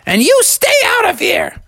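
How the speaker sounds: very high granny warning; see them off in a high old-lady voice; high-pitched feisty grandma; scolding grandma. Acted. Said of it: "And Grandma said".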